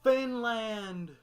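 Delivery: high, drawn-out cartoon shout; rough attempt at a cartoon character's cry; Patrick. Quoting a favorite funny moment to co-host, hammed-up cartoon voice. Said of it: "I can't do his voice".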